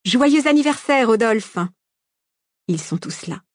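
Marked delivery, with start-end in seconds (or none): 0.1-1.6 high, loud mass birthday cheer; party shout. A narrator's reading. Guests surprising Rodolphe on his birthday, shouting at full voice. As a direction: shout it out to please him. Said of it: "tous s'égosillent pour lui faire plaisir".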